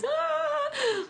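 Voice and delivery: a very high, happy squeal; excited squeal. Real, not acted. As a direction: squeal with excitement as you sign off. Excited sign-off to viewers.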